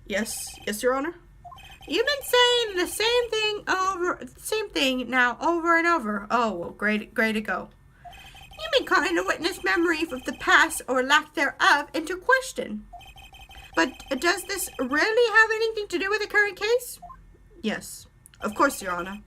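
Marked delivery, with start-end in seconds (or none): 1.9-6.3 high-pitched judge addressing the lawyer; courtroom judge. Acted. 8.6-17.0 high-pitched judge addressing the lawyer; courtroom judge. Acted.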